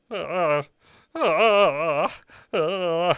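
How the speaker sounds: hammy wordless father sobs; mock sobbing. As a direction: imitate a dad blubbering badly. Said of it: "you see the father, he's like".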